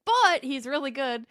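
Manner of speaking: high-pitched fake sales pitch; turning on charm. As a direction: put on an exaggerated salesperson's charm, drawing out the opening. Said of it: "turn on the charm".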